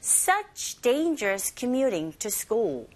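slow, evenly stretched English phrase; slow pronunciation model. Shown as a demonstration. Teacher leads repeat-after-me practice, slow and drawn out. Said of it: "자, 해볼게요".

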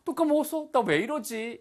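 gasp, then high-pitched dismay; dismayed self-reproach. Acted. Teacher acts having forgotten again, dismayed at self. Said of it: "이런 식의 느낌인 거죠".

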